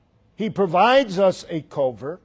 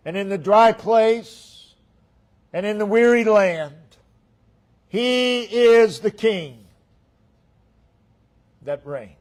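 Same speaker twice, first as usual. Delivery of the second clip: emphatic preaching; 5 semitones higher, 7 dB louder than usual. Real, not acted. Preacher proclaiming the king in hard times, emphatic preaching. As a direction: stretch each phrase out with preacher's force.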